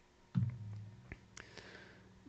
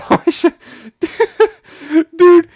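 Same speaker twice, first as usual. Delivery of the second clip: laughing; 8 dB louder than usual. Real, not acted. Laughing at a post.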